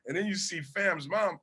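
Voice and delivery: ordinary talk, nothing marked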